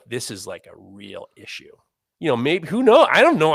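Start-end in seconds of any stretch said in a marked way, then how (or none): 0.1-1.7 hushed grave voice. Retold or imitated. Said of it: "Maybe they were just like".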